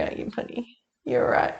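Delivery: soft whisper to the cat; whispered cooing. Real, not acted. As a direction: hush it to the pet.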